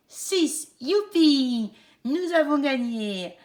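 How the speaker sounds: cheering high, with a laugh; kids show host. Acted. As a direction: celebrate the win for the kids. Wins the game with the children. (animatrice enthousiaste qui rit)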